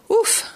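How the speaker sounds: very high, sharp exclaimed ouf; relieved ouf. Acted. Molo just spat out by the tyrannosaur, high relieved ouf. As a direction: let out the relief in one burst.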